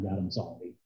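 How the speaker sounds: low, hushed little apology; contrite apology. Acted. Believer apologizing to God, contrite and hushed. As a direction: drop right down, soft and sheepish.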